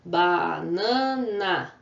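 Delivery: "Banana" slowly stretched into ba, na, na; three long beats for "Banana"; syllable by syllable. Shown as a demonstration. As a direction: draw out every syllable of "Banana". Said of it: "quantas vezes eu abro a boca".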